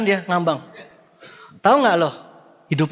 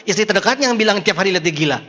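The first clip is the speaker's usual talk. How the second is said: usual speaking voice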